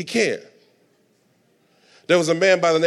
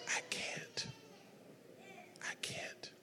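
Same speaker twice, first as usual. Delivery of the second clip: hushed, voiceless whisper; whispered Jesus; 30 dB quieter, slower than usual. Acted. Jesus answering Hagin's plea, whispered. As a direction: whisper it very softly, barely audible. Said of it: "Jesus looks over and says".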